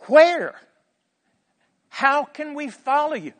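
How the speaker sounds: high, loud, incredulous questions; disciples' alarmed questioning. Acted. Disciples questioning Jesus about his leaving, alarmed and loud. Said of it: "they said things like".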